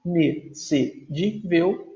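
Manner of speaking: "inexcedível" slowly divided, i-ne-ce-dí-vel; five slow, separate beats on "inexcedível"; syllable by syllable. Shown as a demonstration. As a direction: pause between the syllables of "inexcedível". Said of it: "O X fica numa sílaba".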